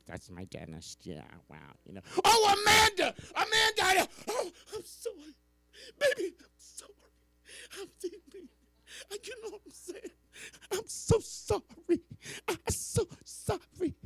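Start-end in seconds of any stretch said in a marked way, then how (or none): 2.2-13.9 high, loud crying apology; sobbing. Acted. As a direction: wail the apology through sobs. Acted sobbing apology to Amanda.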